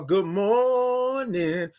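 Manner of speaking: "good morning" stretched very slowly, every vowel held; long drawn-out good morning. Retold or imitated. Host greeting a viewer, "good morning" drawn out slowly. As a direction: savor each sound of "good morning". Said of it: "all them O's and R's and N's".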